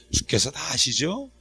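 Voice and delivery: soft, drawn-out appeal; model prayer. Shown as a demonstration. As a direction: quietly hand it over to the Lord. (나직하고 느린 기도조)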